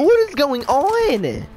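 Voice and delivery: whiny. Real, not acted. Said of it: "sorry for my little whiny voice".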